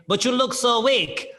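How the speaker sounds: slow, high mock Russian accent; imitated Russian needling his opponent; Russian guy taunting. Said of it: "he says to me".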